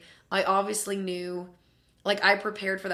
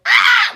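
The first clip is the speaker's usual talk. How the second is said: very high, loud squeal; fangirl scream; 17 dB louder than usual. Retold or imitated. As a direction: shriek like an overjoyed fan. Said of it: "I was screaming".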